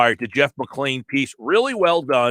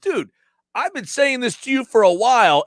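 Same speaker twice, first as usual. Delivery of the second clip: casual buddy ribbing; 8 semitones higher than usual. Retold or imitated. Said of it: "you're like".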